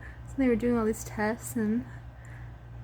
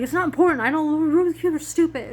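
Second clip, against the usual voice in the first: louder, scoffing brush-off; dismissive outburst; 6 semitones higher, 8 dB louder than usual. Retold or imitated. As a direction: wave it away, loud and fed up. Retold dismissive inner voice.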